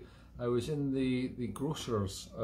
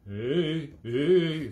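rising mock shouts of hey, getting louder; funny calling out; 4 semitones higher, 10 dB louder, much slower than usual. Calling after a friend who walked past, a hammed-up shout. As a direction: call after him, louder each time. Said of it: "started making funny noises".

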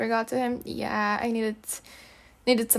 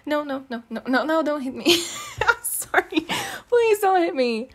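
mock-scared, laughing and higher; laughing mock plea; 4 semitones higher than usual. Begging her pouty friend not to hit her, laughing. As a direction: beg through giggles, not really scared.

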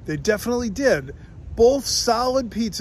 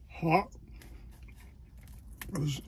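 talking while chewing; muffled words around food; mouth full of pizza; 12 dB quieter than usual. Real, not acted. Seen 2: Bites pizza at start, chews with full mouth while talking.

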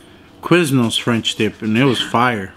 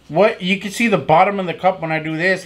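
usual speaking voice

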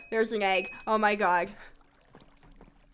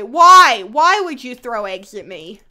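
outraged why; 10 dB louder than usual. Real, not acted. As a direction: yell it in disbelief. Egged by other player, outraged.

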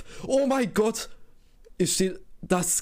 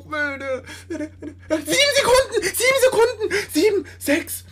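high, loud, desperate begging at the screen; frantic pleading; 7 semitones higher, 5 dB louder than usual. Real, not acted. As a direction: beg the game to let you score, half laughing. Begging through final seconds.

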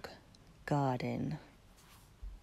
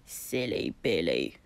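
deep croaky frog voice, much lower; croaking frog; 12 semitones lower, 4 dB louder than usual. A narrator's reading. Frogs croaking at the kitten by the stream, low and croaky. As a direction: croak it out like a big pond frog. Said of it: "croaked the frogs".

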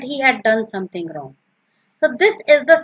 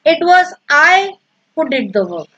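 "I" pitched up and punched, then drop away; stress on I; 2 semitones higher than usual. Shown as a demonstration. Teacher giving an example of emphasis, stress on "I". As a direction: hit "I" hard, let the rest fall. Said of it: "specifically laying emphasis on this".

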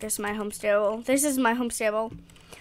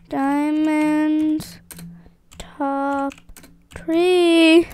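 excited; 3 semitones higher, 8 dB louder, much slower than usual. Real, not acted. Excited announcement of results.

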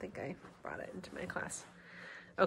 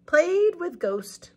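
put-on slow storybook reading for kids; picture-book narration; read-aloud narration; 10 semitones higher, 13 dB louder, much slower than usual. A narrator's reading.

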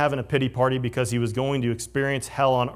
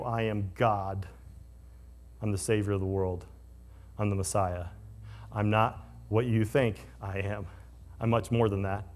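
solemn divine declaration; 5 semitones lower, 8 dB quieter than usual. Retold or imitated. Jesus's new job description.

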